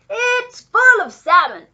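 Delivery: high, loud, slow proclamation; booming, drawn-out confirmation; exclaiming. A narrator's reading. Duke answers screeching pelican.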